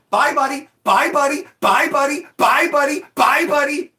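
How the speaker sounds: high, loud, sing-song repeated taunt; mocking farewell yelled again and again; taunting goodbye. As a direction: needle him with every bye. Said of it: "And I'm like".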